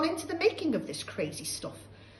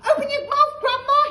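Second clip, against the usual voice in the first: put-on much higher, loud boy's call; urgent cry; 13 dB louder than usual. George rushing to put out grandma's fire, urgent cry. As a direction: call out in a hurry. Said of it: "he cried".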